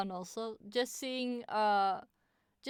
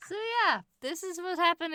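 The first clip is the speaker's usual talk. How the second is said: high voice; 9 semitones higher, 5 dB louder than usual. Pretend. Still pitched up.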